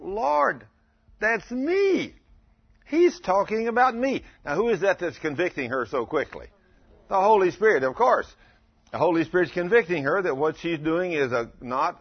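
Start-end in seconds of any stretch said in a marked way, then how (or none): 0.0-4.2 put-on girl's sudden realization. 7.1-11.5 emphatic preaching. Acted. Preacher emphasizes the Holy Spirit.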